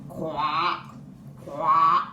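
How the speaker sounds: low croaking crow caw; crow caw imitation. Crow cawing in the night, low croak. Un corbeau croasse.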